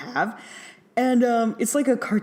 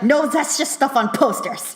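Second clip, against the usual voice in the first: higher, louder mock-irritated brush-off; annoyed professor; 9 semitones higher, 5 dB louder than usual. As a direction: swat the kid's idea away. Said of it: "getting annoyed with the kid's".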